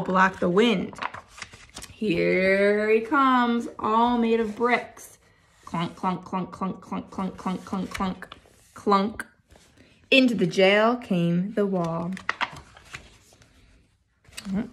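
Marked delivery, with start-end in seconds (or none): 5.6-9.3 heavy, soft clunks, each one plodding; march the clunks along heavily; clunking footsteps. Acted. Brick wall walking sound.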